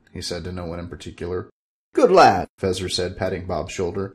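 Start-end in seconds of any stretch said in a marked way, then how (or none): 1.9-2.5 a louder, lightly voiced old wizard; hearty pat-on-the-back praise; Fezzer's voice. Fezzer patting Bob's shoulder, loud hearty wizard voice.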